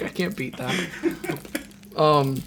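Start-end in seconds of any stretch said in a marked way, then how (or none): 0.0-0.7 soft breathy laugh through words; laughing quietly. Real, not acted. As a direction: giggle it out under breath. Breathless laughter after story.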